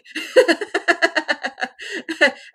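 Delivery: high, loud burst of laughter; long delighted laughing fit after a story; real laughter. Real, not acted. Laughing at her own retold story.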